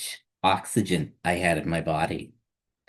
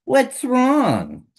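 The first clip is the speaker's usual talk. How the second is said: high, louder worried parent; worried question; 6 dB louder than usual. Acted. Parent asks the hurting child, high and worried. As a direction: ask with alarm what's wrong.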